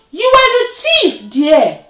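very high, very loud shout; furious storybook leopard yelling; outraged accusation. A narrator's reading. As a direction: point the blame at full voice. Leopard accuses Deer in quarrel.

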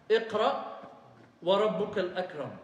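full stop after اقرأ, then وربك الأكرم recited; waqf pause. Shown as a demonstration. Teacher showing scholars' stopping place, with a waqf after اقرأ. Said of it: "there should be a waqf".

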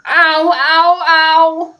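high, loud yelps of pain. A narrator's reading. Jim yelps after eating a green banana. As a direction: cry out with a tummy ache.

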